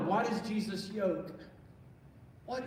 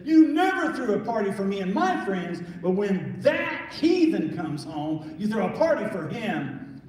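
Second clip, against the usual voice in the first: put-on loud, bitter complaint of the older son; angry brother; 2 semitones higher, 7 dB louder than usual.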